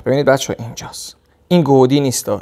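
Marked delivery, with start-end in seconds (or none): 0.6-1.2 whispered aside. Real, not acted. Pointing at the hand close up.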